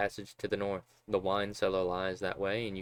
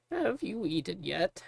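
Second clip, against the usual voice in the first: put-on high, slow kindly old woman; old cook voice; 10 semitones higher, slower than usual. Old cook Magda calling the child to her, high and slow. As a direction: croon it like a sweet old kitchen matron.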